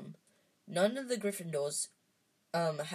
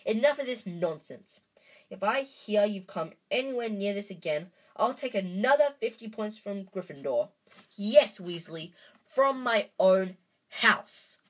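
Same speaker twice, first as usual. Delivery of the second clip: stern scolding, a little louder; stormed; 3 semitones higher, 6 dB louder than usual. A narrator's reading. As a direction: lay down the law to the boys. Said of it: "she stormed".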